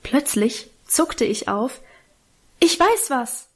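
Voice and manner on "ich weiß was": called out half-aloud. A narrator's reading. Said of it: "rief ich halblaut".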